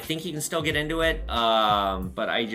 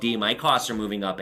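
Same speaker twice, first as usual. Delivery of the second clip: ordinary talk, nothing marked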